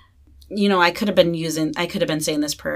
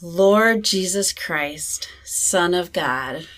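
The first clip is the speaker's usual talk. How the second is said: slow, careful repeated prayer; slow breath prayer; much slower than usual. Shown as a demonstration. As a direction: echo the prayer slowly. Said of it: "Repeat that, [name]".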